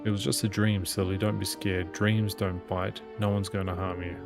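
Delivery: normal talking voice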